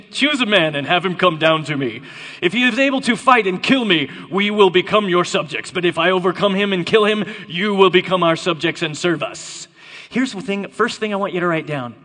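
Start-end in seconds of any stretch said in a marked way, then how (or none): 0.1-9.7 put-on loud challenge in a Connery-like accent; Goliath as Sean Connery. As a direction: declaim it as a champion laying down terms. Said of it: "Sean Connery".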